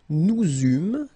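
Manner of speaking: "nous eûmes" linked with a z, nou-zûm; a loud, clear z link in "nous eûmes"; liaison. Shown as a demonstration. Teacher showing learners the link, liaison. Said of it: "make this little link between the two".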